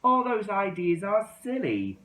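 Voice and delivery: high, loud and bossy. A narrator's reading. Four dismissing his friends' ideas, bossy and loud. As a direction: boss the others around. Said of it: "he said in a very bossy voice".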